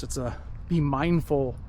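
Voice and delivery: ordinary delivery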